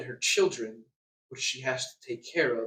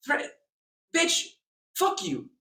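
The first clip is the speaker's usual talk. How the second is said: demo of a threat; 13 semitones higher, 4 dB louder, much slower than usual. Shown as a demonstration. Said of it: "See, that's a threat".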